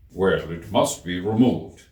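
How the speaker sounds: slow, firm king's voice; decided King. A narrator's reading. King rules the cat must go, slow and firm. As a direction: settle it with a firm ruling. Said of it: "said the King very decidedly".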